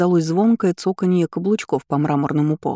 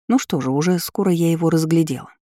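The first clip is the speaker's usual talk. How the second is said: plain reading voice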